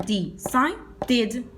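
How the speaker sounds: slow decided, "di-sai-did"; decided with the -ed as a separate "id" syllable; ID ending. Shown as a demonstration. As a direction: break decided into three syllables. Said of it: "le pongo la I D en pronunciación".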